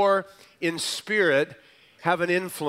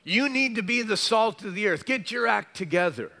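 mock scolding demand; 5 semitones higher than usual. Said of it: "He doesn't say".

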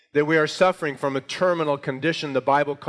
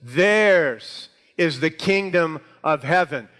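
"theirs" held long, then measured words; stress on THEIRS; 4 dB louder, slower than usual. Shown as a demonstration. Preacher repeats the verse for emphasis, "theirs" drawn out. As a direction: punch and stretch the opening "theirs", slowly.

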